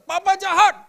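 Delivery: put-on high, loud child's cry of betrayal; child's shout. Child shouting at his father, loud and high. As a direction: yell it like a hurt child at his dad. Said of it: "anak saya teriak".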